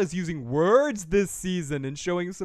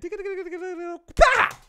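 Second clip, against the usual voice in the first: mock running noise; 7 dB louder than usual. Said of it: "This is Enid running in".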